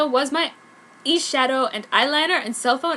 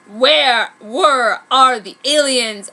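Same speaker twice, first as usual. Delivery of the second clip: loud, slow angry demand; angrily; 7 dB louder, much slower than usual. A narrator's reading. Heroine demands where the aliens are, angry. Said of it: "I said angrily".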